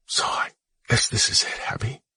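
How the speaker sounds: soft raspy farewell. Acted. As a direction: rasp it out, barely voiced. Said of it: "he said with a raspy voice".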